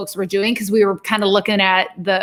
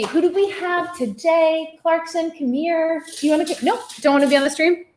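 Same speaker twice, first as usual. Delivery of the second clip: talking to a pet; 7 semitones higher than usual. Real, not acted. Talking sweetly to her dog.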